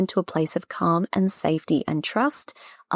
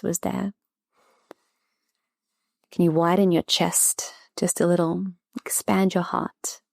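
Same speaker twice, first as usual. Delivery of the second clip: guided breathing. Acted. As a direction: ease the listener into calm, unhurried. Leads a short breathing practice.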